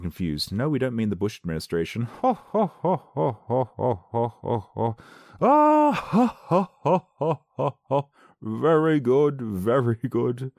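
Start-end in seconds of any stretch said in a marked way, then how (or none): 2.0-8.1 high, loud hammed-up ho ho ho laughter; booming mock ho ho laugh. Reacting to a headline joke, hammed-up ho ho laugh. 8.4-10.5 1 mock approval. Mock praise after laughing.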